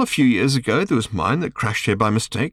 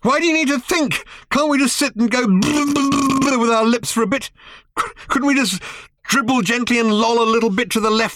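higher, louder despairing tirade; exasperated rant; 3 dB louder than usual. A narrator's reading. Arthur despairing at Ford's thinking, exasperated rant. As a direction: pour out the frustration.

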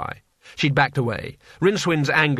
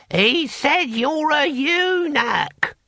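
high, slow bellow into an ear; 14 semitones higher, much slower than usual. Acted. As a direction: bellow it slowly to a deaf man. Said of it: "Boy Willie bellowed in Hamish's ear".

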